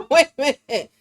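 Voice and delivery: sudden loud startled cry. Real, not acted. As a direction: jump at a noise mid-sentence.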